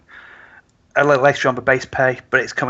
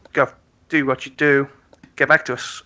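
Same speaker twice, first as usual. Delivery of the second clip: ordinary talk, nothing marked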